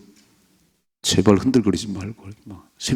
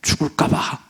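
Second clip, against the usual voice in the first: fearful fretting; 5 semitones higher than usual. Retold or imitated. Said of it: "여전히 내 생각으로".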